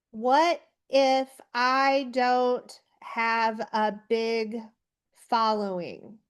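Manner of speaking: slow, spaced-out words as if typing them; dictating while typing. Shown as a demonstration. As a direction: read it out word by word while typing, fading at the end. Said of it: "I'm typing that out".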